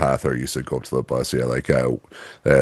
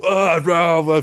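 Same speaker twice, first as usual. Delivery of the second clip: high yelp of alarm; startled yelp. Retold or imitated. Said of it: "I was just doing the".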